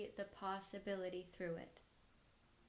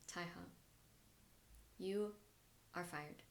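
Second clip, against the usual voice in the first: cold quiet firing; 3 semitones lower, 8 dB quieter than usual. Retold or imitated. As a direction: let the bad news drop softly, pausing. Retold boss firing her.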